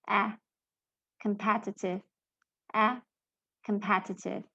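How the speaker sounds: short "eh" alone, then "Competitive" stressed on that vowel. Shown as a demonstration. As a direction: model "Competitive" as "kum-PET-itive". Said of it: "how these words are supposed to be pronounced".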